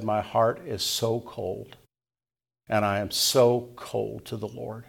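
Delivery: a touch of tearful, slow quoting; understated tearful girl, slow; retold tearful plea. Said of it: "And in tears".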